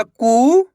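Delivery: "कू" held long, rising at the end; sustained, rising "कू" for dictation; stretched vowel. Shown as a demonstration. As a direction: draw out the ऊ in "कू". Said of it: "खींच के उच्चारण निकल रहा है".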